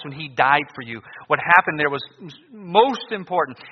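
ordinary talk, nothing marked

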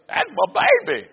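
high-pitched gushing mama voice; doting Southern mama. Retold or imitated. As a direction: gush it high like a proud mother. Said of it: "like that right there".

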